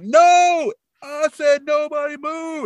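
hammed-up gunman yelling at the mother; mock angry shout. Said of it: "And he's like".

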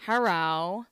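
mock odd hello, drawn out; a lightly voiced weird "hello". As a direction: imitate his strange little greeting. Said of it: "doing his weird".

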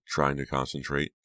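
plain reading voice

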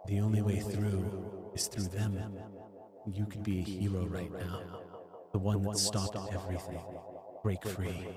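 quiet words meant to carry into his ears. Acted. Ziggy persuading a gunman from afar, very quietly. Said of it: "he's gonna say very quietly".

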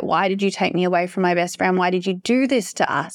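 usual speaking voice

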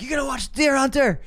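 high, eager pitch to a friend; excited. Retold or imitated. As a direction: push the recommendation with bright excitement. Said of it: "I was probably like".

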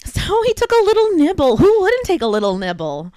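high, loud comic protest; mock lawyer's excuse. Lawyer making light of a client's crime, high mock protest.

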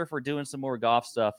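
normal talking voice

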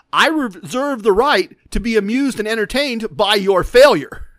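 emphatic, measured retort, FAILURE punched loud; pointed declaration. Retold or imitated. Amused winner answering the losing player, pointed declaration. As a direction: declare it grandly, landing hard on "failure".

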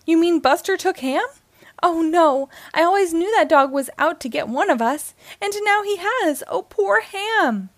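high, loud panicked wail; crying out. A narrator's reading. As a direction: wail in alarm, drawing out the end. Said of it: "Rex cried".

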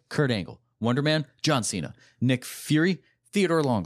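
ordinary talk

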